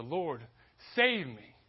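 urgent shouted plea; desperate cry. Shown as a demonstration. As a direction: cry out to God, breaking hard on "save me". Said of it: "I think Peter's "Lord, save me" was a".

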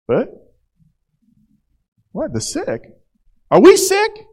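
high, indignant squeak of a question; high mock-offended guest; offended tax collector.

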